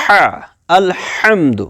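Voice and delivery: ہا and الحمد with strong mid-throat friction; stressed throaty Haa. Shown as a demonstration. Teacher says stress this, ہا forced from mid-throat. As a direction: lean hard on the throaty h in الحمد. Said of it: "اس پر stress دینا ہے".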